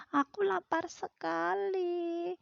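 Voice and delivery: high, slow pleading duck voice; hungry duck. Acted. Teacher voicing the hungry duck to pupils, high slow plea. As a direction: whine it like a starving little duck. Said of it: "Bebeknya bilang gitu".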